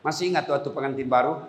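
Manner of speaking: ordinary talk, nothing marked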